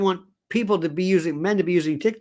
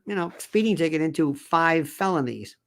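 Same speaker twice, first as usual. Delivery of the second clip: usual speaking voice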